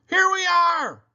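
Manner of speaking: high, loud call over the music; a bright shouted arrival. A narrator's reading. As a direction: yell it over a rock band. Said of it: "Uncle Bill shouted".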